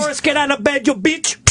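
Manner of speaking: high, mock-scolding caregiver; hammed-up nurse's command; imitating rough nurse. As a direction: order her up in a high put-on voice. Acting the nurse yelling at mother.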